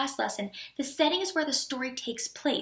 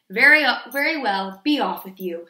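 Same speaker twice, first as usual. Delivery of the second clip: loud troll dismissal; 4 semitones lower, 9 dB louder than usual. A narrator's reading. Troll letting the second goat pass, loud dismissal. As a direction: send them off loudly as the troll. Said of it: "said the troll".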